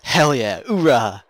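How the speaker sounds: shouted triumphant soldier cheer; loud gung-ho soldier. Real, not acted. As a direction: roar it like a marine. Kill shot, cheering.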